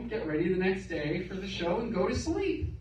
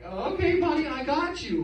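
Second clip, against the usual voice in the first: put-on high, sugary promise to a child; balloon guy fake-reassuring a kid, high and sweet; 6 semitones higher than usual. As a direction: sell the lie in a bright higher voice. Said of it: "I'm like".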